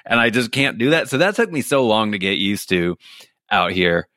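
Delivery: usual speaking voice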